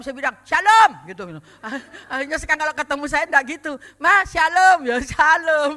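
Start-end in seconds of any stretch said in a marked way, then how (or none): 0.4-0.9 calling greeting. Retold or imitated. Greeting called out loudly. 4.0-5.7 calling greeting. Retold or imitated. Imitating villagers' loud greeting.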